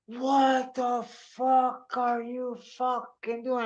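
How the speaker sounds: high, slow, drawn-out mock disbelief; confronting bystander. Onlooker confronting the balloon shooter, slow incredulous voice.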